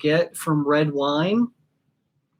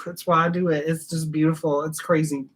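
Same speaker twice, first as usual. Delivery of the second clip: ordinary delivery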